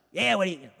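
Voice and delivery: mock cranky grumbling; cranky waitress. Waitress griping at her table, mock cranky. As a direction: imitate a crabby, grumbling waitress. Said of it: "like, cranky and just like".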